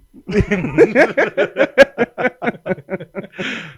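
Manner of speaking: long, loud burst of laughter. Real, not acted. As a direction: break up laughing hard. Big laugh after the slogan.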